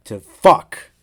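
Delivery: loud curse at a flub; real loud curse as the take breaks; frustrated outburst. Real, not acted. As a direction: blurt it out, annoyed. Flubs the line.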